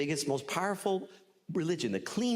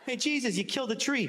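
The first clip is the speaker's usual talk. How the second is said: disciple accusing; 8 semitones higher than usual. Acted.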